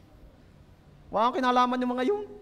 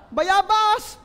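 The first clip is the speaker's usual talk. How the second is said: loud, high comic reveal; triumphant shouted punchline; 6 semitones higher, 6 dB louder than usual. Real, not acted. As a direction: bellow the answer for the laugh.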